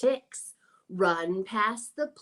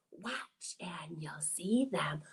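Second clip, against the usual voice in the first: soft hushed build-up; whispered suspense; 6 semitones lower, 8 dB quieter than usual. Pretend. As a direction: draw them in quietly.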